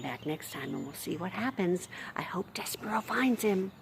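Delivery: soft whisper; hushed goodnight wish. Real, not acted. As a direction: whisper the hope to the listeners. Signing off to children.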